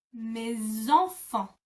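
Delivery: "Mes enfants" slow with a Z link, /me-zɑ̃-fɑ̃/; very slow "Mes enfants" with a Z sound; liaison demo. Shown as a demonstration. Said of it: "the S becomes a Z sound".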